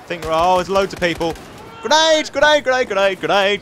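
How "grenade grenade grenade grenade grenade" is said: high loud repeated alarm; grenade warning yell. Real, not acted. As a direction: yell it again and again.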